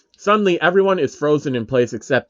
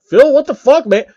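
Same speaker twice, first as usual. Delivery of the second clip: much higher and louder, betrayed shout; outraged yell; 11 semitones higher, 8 dB louder than usual. Acted. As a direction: yell at the friend who shoved you in. Klein pushed into the boss.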